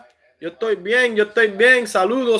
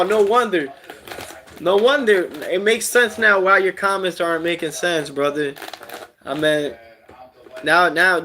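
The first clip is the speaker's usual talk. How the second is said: usual speaking voice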